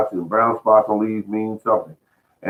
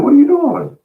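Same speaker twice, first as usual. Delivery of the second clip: much higher and louder, astonished; startled neighbor; 6 dB louder than usual. Retold or imitated. Neighbor sees him throwing clippings, high startled cry. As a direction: burst out at the odd sight. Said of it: "And he said".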